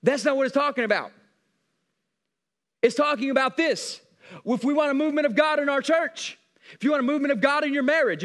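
emphatic, driving sermon delivery; full-force preaching. Acted. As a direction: preach it hard to the congregation.

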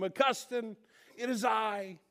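high, half-hearted call across the street; mild mock yell. Ex-lover waving to Augustine, mock yelling. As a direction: imitate an old flame calling out. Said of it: "she starts yelling to him".

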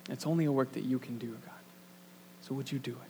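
hushed, fast whispered plea; a soft intimate whisper to God; whispered prayer. Real, not acted. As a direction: pray it under your breath. Quiet prayer to close.

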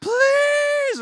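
put-on high, loud wailing child's please; begging child. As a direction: wail it like a kid begging for a toy. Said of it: "little kids pleading".

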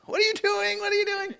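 very high-pitched mock yell; imitated yelling. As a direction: squeal it out like a man yelling in protest. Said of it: "The guy was yelling at me".

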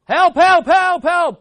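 rapid repeated high shouts; loud, panicked, acted screams for help; frantic drowning cry. Shown as a demonstration. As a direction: yell over and over for rescue. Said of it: "What do you do?".

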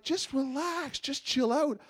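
wife brushing off her husband, carefree; breezy wife. Retold or imitated. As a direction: shrug it all off, unbothered. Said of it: "And she's just like".

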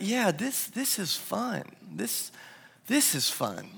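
a father's tender private thought; quiet reflection. Retold or imitated. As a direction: let it sink in gently.

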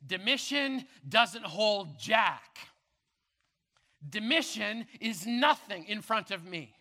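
loud, rising sermon climax, hammering each key; full-force preaching. Acted. Preacher thunders to the congregation about the keys, loud and driving.

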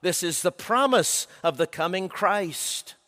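normal talking voice

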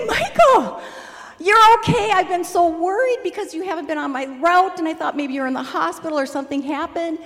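high, loud joyful outburst; bursting with relieved joy; excited relief. Retold or imitated. Retelling finding Michael standing, high excited relief. Sees healed man at door.

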